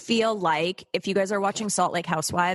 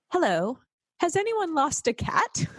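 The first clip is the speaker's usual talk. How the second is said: mock flight attendant over the intercom, higher; intercom announcement; 6 semitones higher than usual. Narrator mimics plane intercom asking about a lost cat. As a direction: announce it like a pilot on the PA. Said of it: "over the intercom, we hear".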